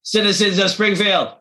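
high-pitched cartoon mayor voice; Simpsons mayor. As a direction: address the town as a cartoon politician. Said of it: "the Simpsons mayor, Springfield, his version".

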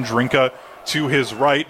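ordinary talk, nothing marked

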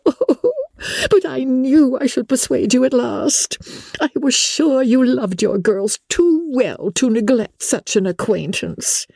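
very high, squealing matron's delight; rapturous. A narrator's reading. Wife thanking husband for visiting Bingley, rapturous. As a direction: gush ecstatically at the top of your range. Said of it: "fatigued with the raptures of his wife".

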